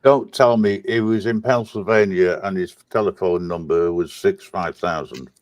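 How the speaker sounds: ordinary talk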